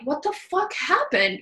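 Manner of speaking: high, startled what-happened; alarmed question. Retold or imitated. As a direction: sound shocked at finding her like this. Someone finds her falling apart.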